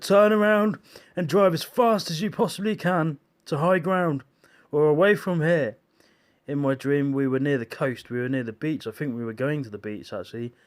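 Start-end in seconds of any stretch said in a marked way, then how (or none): 0.0-5.8 loud, higher urgent order; urgent command. Retold or imitated. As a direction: push them to drive away fast. Soldier orders them to flee.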